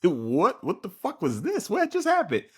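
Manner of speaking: high, hammed-up incredulous questioning; baffled disbelief. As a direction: voice leaping up high in confusion. So I'm confused.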